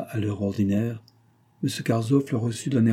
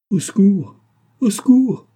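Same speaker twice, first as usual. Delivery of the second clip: panicked cry, much higher and louder; cry for help; 10 semitones higher, 8 dB louder than usual. A narrator's reading. Old nurse screams for help as the girl faints, panicked. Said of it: "cria la Nia Nia".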